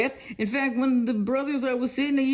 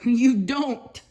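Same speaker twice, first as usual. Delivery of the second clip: ordinary talk, nothing marked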